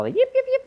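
high yapping yips of a small dog; yip, yip, yip in a tiny dog's voice; little dog yips. Retold or imitated. Preacher imitating a little dog's yapping bark. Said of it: "little barks".

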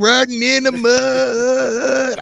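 high, slow, hollered country rap line. Retold or imitated. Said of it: "It's like".

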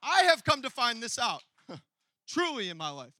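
loud, emphatic declaration; full-force preaching. Real, not acted. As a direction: proclaim it to the whole room.

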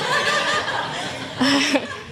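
high-pitched burst of laughter. Real, not acted. Laughs at own joke to church.

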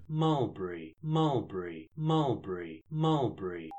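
steady repeated Mulberry, falling each time; mulberry chant. Acted. Actor doing crowd murmur for a sound effect, falling chant. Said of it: "I've got that effect".

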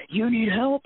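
loud, high imitated challenge; acted call-out. Big women on the corner to a victim, acted shout. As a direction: call it out like a bystander ready to charge. Bystanders calling out, ready to charge.

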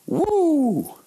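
high, long "woo"; drawn-out high-pitched cheer; celebratory whoop. Acted. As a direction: whoop in triumph. Comedian celebrating big laughs.

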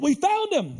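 high, eager burst of news; thrilled to share the discovery; excited announcement. Acted. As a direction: put an exclamation mark on it. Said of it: "And he's excited".

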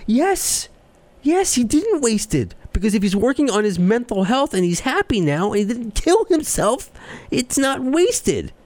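a worked-up rebuttal at the top of the voice; heated indignation. Real, not acted. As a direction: push it up high, fed up and defending. Indignant rant against the troll.

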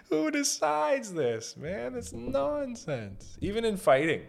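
high, slow, laughing voice; laughing disbelief. Real, not acted. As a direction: squeal out the disbelief. Laughing high incredulous rant.

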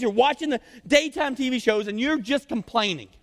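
high, forceful berating, slowing at the end; mock scolding rant. Preacher scolds idle daytime TV watchers, high and exasperated.

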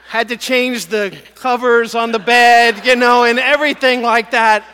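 can't stop laughing as you tell it; preacher cracking up at his own story, laughing loudly. Real, not acted. Laughing over the childhood scare.

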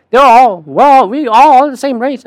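loud, high mock Southern accent; hammed-up Southern preacher-like delivery. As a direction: proclaim it in a put-on Southern accent. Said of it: "I'm doing a Southern accent".

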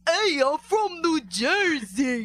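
horrible fake New Jersey accent, loud and drawn out; slow, high, blaring New Jersey caricature; Joseph Gordon-Levitt. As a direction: ham up a bad Jersey accent. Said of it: "remember his accent in that".

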